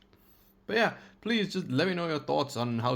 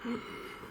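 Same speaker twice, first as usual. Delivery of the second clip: sharp gasp; 11 semitones higher, 11 dB quieter than usual. Acted. Villain skit after the goodbye.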